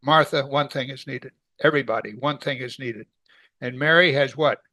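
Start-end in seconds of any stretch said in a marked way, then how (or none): none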